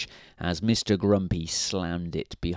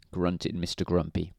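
ordinary delivery

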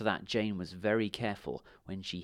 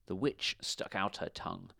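ordinary delivery

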